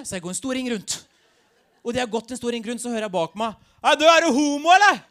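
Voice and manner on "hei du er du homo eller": loud, high heckling shout; acted confrontational holler; youth calling out. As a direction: yell it after someone in the street. Street youth calls out behind him.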